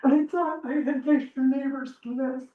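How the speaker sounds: high, strained voice near tears; hurt, holding back tears; emotional. Real, not acted. Upset neighbor telling council she is hurt, near tears. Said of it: "I apologize if I'm emotional".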